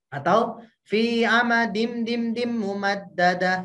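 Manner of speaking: fi amadim dim dim mumaddadah, slow, tapping out each beat; counted ghunnah beats. Shown as a demonstration. Teacher counts the three beats for the mother. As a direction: count the nasal beats aloud. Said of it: "penekanan sebesar tiga harokat".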